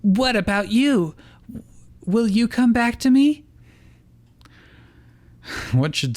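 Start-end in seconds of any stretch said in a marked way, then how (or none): 0.0-3.5 put-on high begging girl voice; pleading Misty. A narrator's reading. Misty begging her former Pokemon to return, high and pleading. Misty begs Starmie back.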